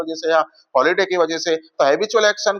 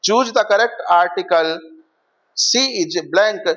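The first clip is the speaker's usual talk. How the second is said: slow dictation; much slower than usual. Shown as a demonstration. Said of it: "reads exam question aloud".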